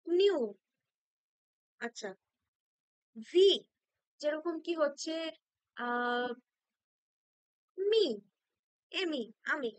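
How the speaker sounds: ordinary delivery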